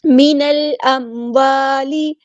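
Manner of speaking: slow, drawn-out tajweed chant; Quran recitation. Shown as a demonstration. Teacher recites for students, slow melodic chant. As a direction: stretch each syllable melodically.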